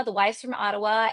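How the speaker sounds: normal talking voice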